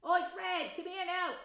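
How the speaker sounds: high, loud shout to his brother; calling out. Acted. Twin shouting for Fred to come help, loud. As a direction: yell across the platform.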